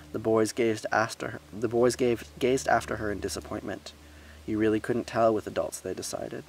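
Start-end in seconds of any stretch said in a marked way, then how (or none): none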